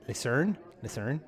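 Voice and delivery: Bristol local accent; Bristolian accent. Shown as a demonstration. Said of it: "That's how they speak round here".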